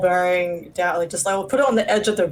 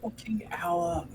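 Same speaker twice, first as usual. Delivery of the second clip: whispering; 11 dB quieter, slower than usual. Real, not acted. Muttered grumble at the table.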